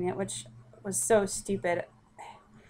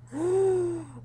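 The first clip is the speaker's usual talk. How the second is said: a high, sharp gasp of shock; 9 semitones higher than usual. Retold or imitated. Telling how she saw the test, a high shocked gasp. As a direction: gasp like the floor just dropped. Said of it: "I was like".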